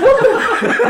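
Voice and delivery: high, stunned mock outcry; incredulous. Worker retelling Roberto's jab to friends, incredulous. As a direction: pitch shoots up in disbelief.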